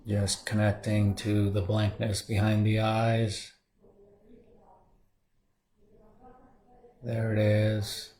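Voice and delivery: soft, calm meditation guide voice; soft guiding voice settling into the body scan; guided meditation. Acted. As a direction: ease them into stillness quietly. Leads a meditation.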